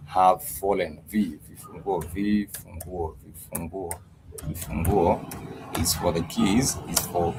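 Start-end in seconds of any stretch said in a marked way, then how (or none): 1.1-5.8 vifunguo said slowly and softly, vi-fu-ngu-o; vifunguo, quiet slow model; softly drilled vifunguo. Shown as a demonstration. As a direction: repeat vifunguo quietly for learners. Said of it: "you would say vifunguo".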